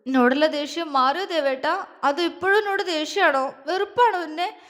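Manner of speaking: high, pleading tearful questions; acted whimpering plea; on verge of sobbing. A narrator's reading. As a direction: climb in pitch as the tears well up. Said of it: "അത് ചോദിച്ചപ്പോഴേക്കും അവൾ വിതുമ്പിപ്പോയി".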